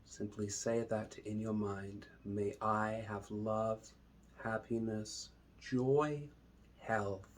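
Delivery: slow, drawn-out meditation voice with long pauses; unhurried, measured calm, lingering on each phrase; guided meditation. Acted. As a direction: guide them calmly into the breath.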